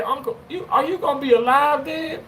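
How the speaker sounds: high, lightly voiced little girl asking innocently; niece's voice. Niece questions uncle's health.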